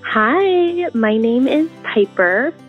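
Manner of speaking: ordinary talk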